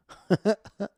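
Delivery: a quick high burst of laughter; short loud laugh; sudden laugh. Real, not acted. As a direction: laugh at your own brag. Laughs at his own bravado.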